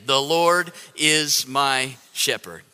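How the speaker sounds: measured and deliberate, room to join in; congregational recitation. Real, not acted. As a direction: lead them through it word by word. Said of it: "Let's say it again".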